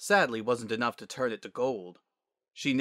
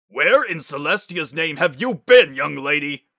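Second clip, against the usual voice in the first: loud, raised-pitch scolding; angry yell; 5 semitones higher, 12 dB louder than usual. A narrator's reading. Father demanding where daughter has been, yelling. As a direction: bellow like a furious father. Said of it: "he yelled".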